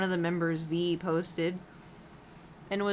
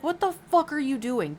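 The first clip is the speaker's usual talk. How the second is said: high, loud mock scolding; pretend angry scolding; 7 semitones higher, 5 dB louder than usual. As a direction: tell him off as if you were his furious manager. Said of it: "I'd be pissed".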